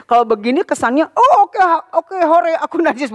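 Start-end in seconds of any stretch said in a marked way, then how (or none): 1.1-3.1 cheerful, the wrong way. Shown as a demonstration. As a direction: sound pleased about being unclean, the wrong way. (sorak gembira yang pura-pura, cara yang salah)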